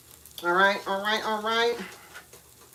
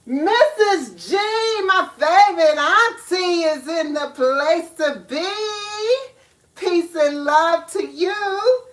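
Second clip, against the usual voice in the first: excited greeting; 9 semitones higher, 7 dB louder, slower than usual. Real, not acted. Greeting a favorite auntie.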